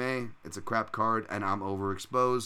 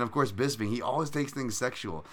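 ordinary talk, nothing marked

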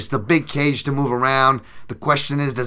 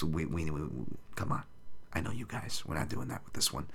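soft, nearly whispered; hushed aside; 5 semitones lower, 15 dB quieter than usual. Real, not acted. Confiding to viewers, softly, almost whispered. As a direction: drop your voice to a confiding hush.

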